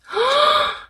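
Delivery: a high sudden intake of breath; sharp gasp. A narrator's reading. Edward hears he is the best, sharp gasp. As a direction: gasp in delight at the news. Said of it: "gasped Edward".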